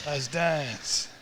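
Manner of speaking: low, soft catchphrase; mock action hero. Hero before a fight, low mock-cool voice. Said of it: "And I just go".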